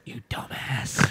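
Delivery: whispered comic insult; mock Whisperer. Co-host playing a Whisperer insulting someone, whispering. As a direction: whisper it like a scolding. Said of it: "maybe that's why one of them said".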